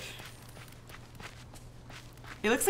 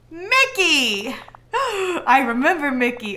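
surprised; 3 semitones higher, 4 dB louder, slower than usual. Acted. Minnie suddenly remembers.

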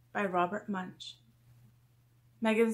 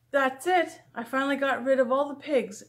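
triumphant; 4 semitones higher, 5 dB louder than usual. A narrator's reading. Megan declares the pigs gone.

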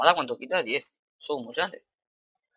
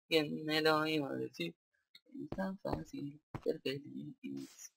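slow, soft, lingering delivery; dreamy drawn-out echo; 9 dB quieter, much slower than usual. Pretend. Player savouring his first night's sleep in the series, dreamy and slow. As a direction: stretch it out softly, savouring each word.

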